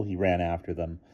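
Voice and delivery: ordinary delivery, nothing marked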